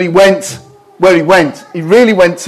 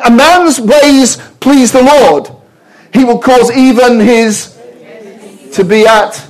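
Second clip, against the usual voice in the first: preaching; 5 semitones higher, slower than usual. Acted. Pastor proclaims the blessing to his congregation, slow and full force.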